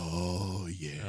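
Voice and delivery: deep booming "oh yeah"; low, drawn-out Kool-Aid Man cry. Pretend. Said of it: "Kool-Aid Man voice he had going".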